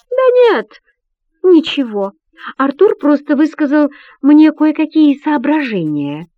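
high, light princess voice, covering up; voiced high and innocent.

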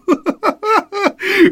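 put-on shrill boyish cackle; a boy rolling on the floor laughing; high squealing laugh. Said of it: "вопил Чарльз Бейтс".

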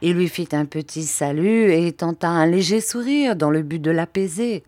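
plain reading voice

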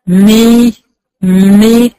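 lips touch, then glide into a thin مي; kasra meem demo. Shown as a demonstration. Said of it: "لو الميم مكسورة هقول إيه؟".

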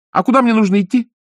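put-on high, eager puppet boy; wooden boy voice. A narrator's reading. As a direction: pipe it like a small boy.

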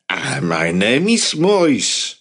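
put-on slow French-accented introduction. A narrator's reading. Maury introducing himself to Jacob in a French accent. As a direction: lean into the French accent, unhurried. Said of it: "He spoke with a thick French accent".

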